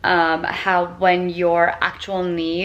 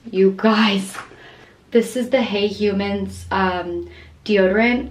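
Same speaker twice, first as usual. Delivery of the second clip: ordinary talk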